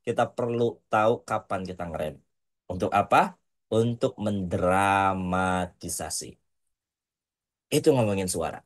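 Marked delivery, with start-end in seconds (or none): 2.7-6.4 "mendramatisasi" drawn out slowly, broken by pauses; slow right down and break up "mendramatisasi" for drama; low, slow "mendramatisasi" with deliberate gaps; dramatic pauses. Shown as a demonstration. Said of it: "pastikan sempurnakan dengan jeda".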